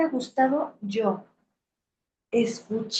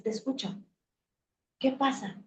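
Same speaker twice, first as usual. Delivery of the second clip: much softer, gentle questions; tender listening; 8 dB quieter than usual. Shown as a demonstration. To her inner child, softly asking what's wrong. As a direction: quietly invite the inner child to speak. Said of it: "Y dices".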